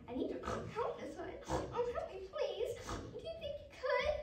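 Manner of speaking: high, pleading piggy voice; plaintive little pig squeak; pig crying for help. Acted. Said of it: "Pig cried".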